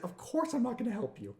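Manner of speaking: syrupy customer-service brush-off; mock-sweet high refusal. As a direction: turn the refusal into a high sing-song. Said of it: "And so I just went".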